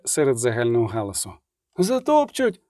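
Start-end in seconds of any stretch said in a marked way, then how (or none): 1.8-2.6 high panicked yelp; shrieking. A narrator's reading. Dandelion lies in the stampede, shrieking. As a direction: squeal it as if about to be trampled. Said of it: "верещав Любисток".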